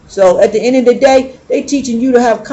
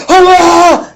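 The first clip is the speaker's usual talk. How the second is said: angry yell; 6 semitones higher, 6 dB louder than usual. Retold or imitated. Angry son's outburst retold.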